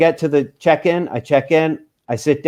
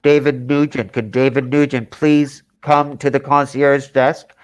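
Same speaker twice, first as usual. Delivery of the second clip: slow, even public-address call, lightly imitated; mock intercom voice; airport PA announcement. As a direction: page a passenger over an airport speaker, slowly. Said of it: "I hear on the speaker".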